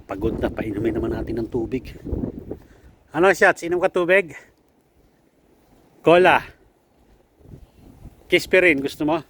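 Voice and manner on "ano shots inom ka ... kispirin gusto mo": playful coaxing. Real, not acted. Offering drinks to pet, playful.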